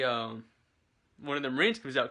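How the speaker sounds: ordinary talk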